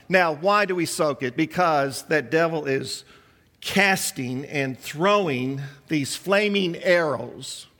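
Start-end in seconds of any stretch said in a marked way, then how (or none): none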